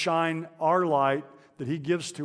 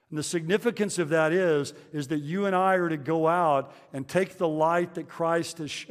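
ordinary talk

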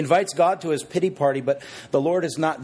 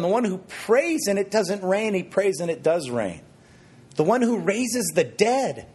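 incredulous; 6 semitones higher than usual. Acted. Builds up Elijah's greatness in disbelief.

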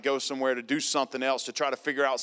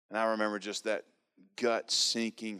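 ordinary talk, nothing marked

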